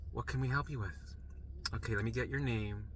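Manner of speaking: soft, weary front-desk voice; mildly fed-up politeness, kept quiet; tired of him. Retold or imitated. Nurses to a regular patient, understated weariness. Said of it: "they're fucking tired of me when".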